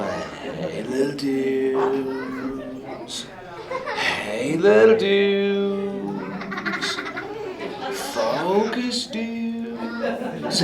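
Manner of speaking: very slow talking. Acted. Turtle greeting tiny kids.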